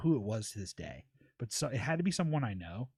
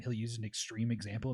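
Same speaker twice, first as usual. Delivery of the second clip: ordinary talk, nothing marked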